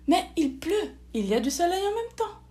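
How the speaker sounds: high, dawning excitement; excited realisation. A narrator's reading. Charlotte spotting sun with rain, excited realisation. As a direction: voice lifts as the idea lands.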